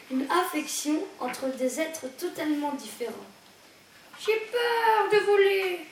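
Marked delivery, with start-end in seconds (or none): 4.3-5.9 high, slow, chirping little bird voice. Acted. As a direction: peep it out like a frightened chick. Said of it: "piailla Afortunada".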